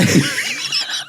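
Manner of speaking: breathless high laugh; airy laugh. Real, not acted. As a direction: burst out laughing silently. Laughing at the outcome.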